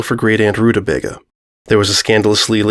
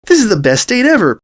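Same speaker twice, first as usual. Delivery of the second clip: high, thrilled exclamation; excited exclaim; 10 semitones higher than usual. A narrator's reading. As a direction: burst out with it like the best news ever. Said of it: "Pinkie exclaimed".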